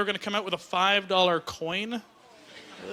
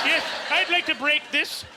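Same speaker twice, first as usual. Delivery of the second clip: raised polite customer voice; bank customer; 5 semitones higher than usual. Acted. Customer at the bank with a giant coin, mock polite. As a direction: play a customer making an earnest request. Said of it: "Be like".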